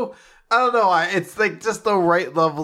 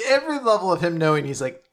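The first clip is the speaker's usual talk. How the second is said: ordinary talk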